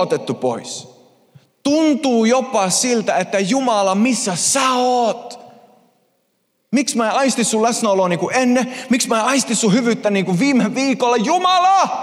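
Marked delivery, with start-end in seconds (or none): 3.4-11.9 pleading prayer rising to a high shouted Jumala; desperate cry. Pretend. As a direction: call out to a silent God in anguish. Imagined believer crying out to God.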